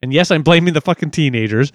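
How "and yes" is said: ordinary talk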